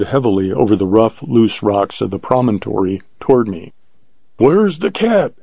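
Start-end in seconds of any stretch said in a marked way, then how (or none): 4.4-5.3 high-pitched, mildly booming demand; booming question. A narrator's reading. Hunter demands the cat's whereabouts, booming. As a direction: call it out high, a mild boom. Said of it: "he boomed".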